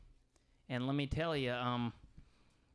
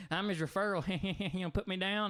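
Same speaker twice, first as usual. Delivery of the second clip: higher-pitched, giggly imitation of a stranger; giggling lady; 6 semitones higher than usual. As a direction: giggle between phrases, voice pitched up.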